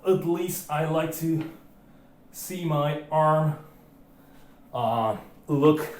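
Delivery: talking between heavy breaths. Real, not acted. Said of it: "Now I'm out of breath".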